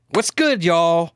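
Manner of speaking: high, loud influencer greeting; punchy mock vlogger hello; mock YouTuber tempo. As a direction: greet like an over-emphatic YouTuber. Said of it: "people are speaking in a tempo".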